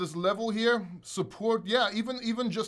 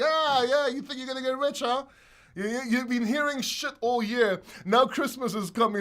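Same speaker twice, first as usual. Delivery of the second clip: taunting; 5 semitones higher than usual. Retold or imitated. Taunting no coiners.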